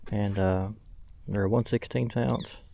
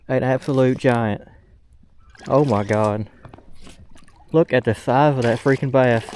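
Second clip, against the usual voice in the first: real giddy hollering over a giant bass; excited shouting; 2 semitones higher, 4 dB louder than usual. Real, not acted. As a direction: yell in real shock at a huge catch. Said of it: "I gotta catch my breath".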